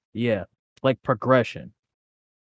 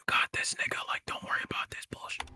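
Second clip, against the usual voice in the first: hushed, unvoiced-breath whisper like a secret deal; furtive whisper; 12 dB quieter than usual. Selling positivity under the table like drugs, furtive whisper. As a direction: whisper it like you're slipping contraband.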